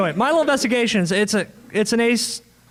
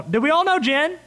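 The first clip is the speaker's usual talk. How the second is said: higher, rallying question to an audience; calling to the crowd; 9 semitones higher than usual. Real, not acted. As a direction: throw the question out to the room. Calls out to the audience.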